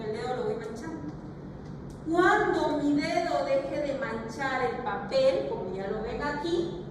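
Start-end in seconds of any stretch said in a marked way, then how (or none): none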